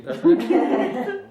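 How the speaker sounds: doesn't it, tossed off high with a laugh; wry laughing irony. Real, not acted. Pastor jokes to the congregation, high laughing irony. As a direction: laugh at the grim verse you just read. Ironic aside after grim scripture.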